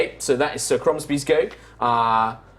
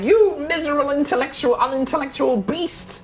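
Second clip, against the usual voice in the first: much higher, pompous squeal; indignant elf; 12 semitones higher than usual. Acted. Elf insults the barbarian in a high indignant squeal. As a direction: sputter the insult in a high prissy voice. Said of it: "he's just like".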